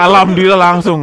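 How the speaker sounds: loud laugh then thankful cry; laughing relief. Real, not acted. Laughs recalling the result. (tertawa lega, lebih keras)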